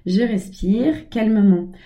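slow, calm self-instruction; slow right down as if breathing out; a drawn-out calming reminder to herself. Shown as a demonstration. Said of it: "pour me calmer".

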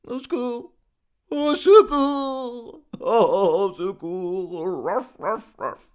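high, loud howl breaking into barks; crying dog. A narrator's reading. Dog under his master's window, crying out and barking. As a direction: wail long and high, then bark like a dog. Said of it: "cria le chien".

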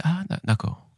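sudden high, awed "ah, d'accord"; a put-on gasp of admiration; mock impressed. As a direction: play it like you've just been dazzled by the buzzword. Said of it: "on fait".

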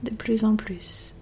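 unhurried relaxation voice, letting silence settle; guided meditation. Acted. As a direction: guide the listener gently into rest, phrase by phrase. Leads a yoga nidra relaxation.

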